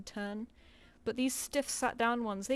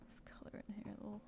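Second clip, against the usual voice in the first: quiet whisper to herself; 2 semitones lower, 11 dB quieter than usual. Real, not acted. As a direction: murmur while concentrating.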